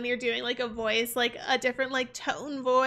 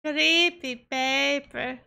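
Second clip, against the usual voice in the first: slow, drawn-out mock vampire; put-on vampire catchphrase; 4 dB louder, much slower than usual. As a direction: stretch the words like a vampire. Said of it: "become a vampire just so I could just be like".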